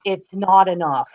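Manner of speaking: normal talking voice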